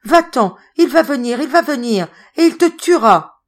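high, loud frantic warning; delirious terror. Acted. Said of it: "Ils virent qu'elle délirait". (cri de terreur délirant, aigu et fort)

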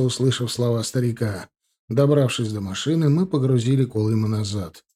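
plain reading voice

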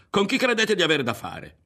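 indignant shouted question; shouted challenge. A narrator's reading. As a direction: challenge the group, pitch way up. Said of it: "gridò".

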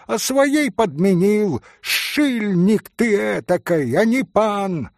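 high-pitched heated exposure of a cheat; indignant accusation. A narrator's reading. Хозяин уличает шулера. (возмущённое обличение)

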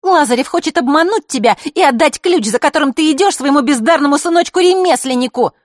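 put-on high, loud spiteful girl's voice. A narrator's reading. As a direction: fling the accusation at him. Маришка accuses.

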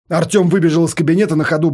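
ordinary delivery, nothing marked

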